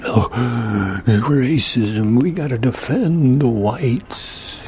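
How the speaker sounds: mock gasping alarm, drawn out. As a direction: ham up a clutching-pearls outrage.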